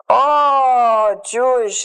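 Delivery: long high drawn-out "Oh", then easing down; joyful recognition. Retold or imitated. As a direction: cry out in delighted recognition. Retold stranger's joyful recognition before hug.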